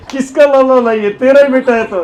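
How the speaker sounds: loud, high laughing retort; laughing mockery. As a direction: laugh at her as you answer. Yashoda laughs at the gopi. (हँसते हुए ऊँची आवाज़ में ताना)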